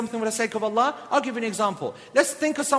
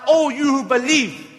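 ordinary delivery

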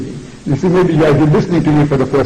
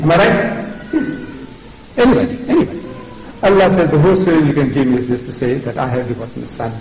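ordinary talk, nothing marked

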